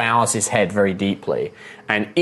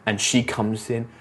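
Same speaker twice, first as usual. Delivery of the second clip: ordinary talk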